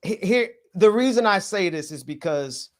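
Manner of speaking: normal talking voice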